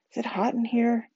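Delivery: high, small, unwell question; weakly. A narrator's reading. Claire, about to be sick, asks the table, weakly. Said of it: "she said weakly".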